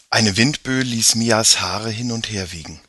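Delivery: usual speaking voice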